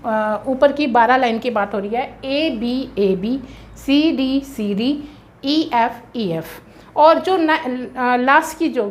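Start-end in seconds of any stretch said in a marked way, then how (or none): 2.3-6.5 1 slow dictation. Shown as a demonstration. Teacher spells out rhyme scheme.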